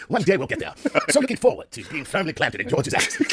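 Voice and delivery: playful mock-British, laughter creeping in; British accent. Comic looking forward to the voyage, British accent. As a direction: keep up a silly British gentleman's accent. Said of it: "You say with a British accent".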